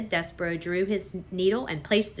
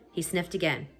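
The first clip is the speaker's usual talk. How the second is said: usual speaking voice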